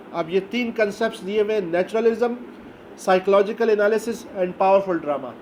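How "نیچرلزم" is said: normal talking voice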